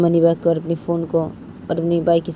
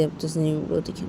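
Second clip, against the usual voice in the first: plain reading voice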